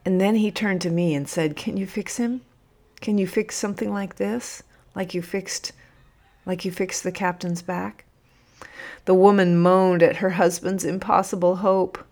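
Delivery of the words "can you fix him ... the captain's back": soft, hopeful plea; father asking softly, pitch a touch raised; hushed pleading. A narrator's reading. Father pleads for his injured son.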